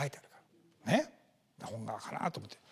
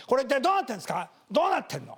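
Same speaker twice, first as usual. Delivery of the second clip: quick, higher mock shouting; mock loud complaint; 5 semitones higher, 3 dB louder, faster than usual. Stranger shouting at someone, mock loud complaint. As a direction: play the angry shouter, fast. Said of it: "大声でなんかの、なんか言ってる人がいて".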